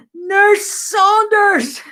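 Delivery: huge drawn-out high bellow; gulder. Retold or imitated. Ward sister summoning late nurse, bellowing. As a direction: roar the name down a long ward. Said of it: "It was a gulder down the ward".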